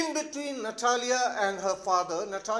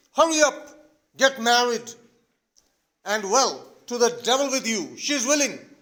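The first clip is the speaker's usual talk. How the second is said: impatient father; 3 semitones higher, 7 dB louder than usual. Acted. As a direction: push them into marriage, shouting. Father impatiently forces the marriage.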